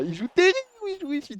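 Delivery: high disbelieving exclamation; can't believe what he's seeing; incredulous. Real, not acted. (stupéfait)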